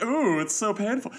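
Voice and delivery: high, hammed-up mock pain; fake hurt. Fan imitating Mark's fake-hurt hand, mock pain. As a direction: wail it as badly faked agony. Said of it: "fake hurts his hand".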